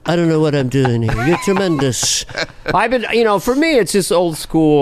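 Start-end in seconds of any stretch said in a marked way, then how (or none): 0.1-2.2 flattering Trump mumble; Trump impression. Trump flattering the crowd, in his voice. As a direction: gush praise in a Trump voice.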